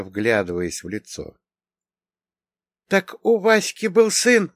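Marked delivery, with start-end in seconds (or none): 2.9-4.5 high, loud, drawn-out amazement; amazed high-voiced woman; astonished. A narrator's reading. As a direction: exclaim in stunned disbelief, pitched high. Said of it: "поражённо произнесла она".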